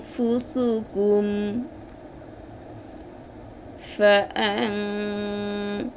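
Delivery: আনফুসুকুম chanted slowly with the নুন hidden in a nasal hum; nasalised ikhfa. Shown as a demonstration. Teacher demonstrating ikhfa to students, slow nasal chant. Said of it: "দেখেন".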